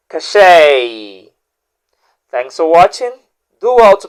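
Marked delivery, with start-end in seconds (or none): 0.1-1.3 "Cachet" said ka-SHAY with a silent t; "Cachet" ending on an open "ay"; correct pronunciation. Shown as a demonstration. Teacher recapping the second word for viewers, careful pronunciation. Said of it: "How about the second word?".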